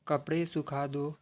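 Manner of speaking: calm English lesson dictation. Shown as a demonstration. Slow vocabulary drill sentences.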